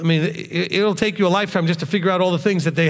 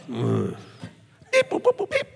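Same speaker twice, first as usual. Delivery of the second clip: robot beeps; 8 semitones lower, 5 dB quieter, much slower than usual. Shown as a demonstration. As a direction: beep and boop like a robot. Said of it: "You know what? ... do that".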